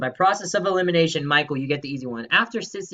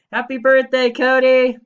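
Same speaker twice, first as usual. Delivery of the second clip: high, loud happy birthday; loud festive greeting; excited birthday shout; 10 semitones higher, 10 dB louder than usual. Real, not acted. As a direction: cheer it out.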